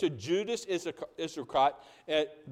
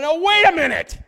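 much higher and louder, put-on voice; disciples protesting; 11 semitones higher, 12 dB louder than usual. Disciples objecting to Jesus, a high loud put-on protest. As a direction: play the indignant disciples. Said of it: "why they're talking like that".

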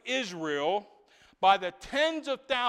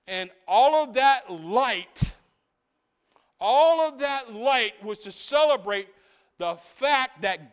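slow, loud, drawn-out preaching climax; full-force preaching; 6 dB louder, slower than usual. Acted. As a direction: let every word ring out over the crowd.

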